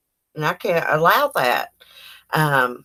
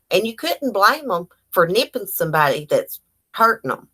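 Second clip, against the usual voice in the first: ordinary delivery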